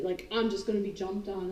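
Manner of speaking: ordinary talk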